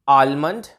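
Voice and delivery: आलमंड with the L sounded; mispronounced almond. Shown as a demonstration. Teacher showing learners the common mistake, mispronounced with L. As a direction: say आलमंड with an audible L after the first vowel. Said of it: "ल का sound आ रहा है".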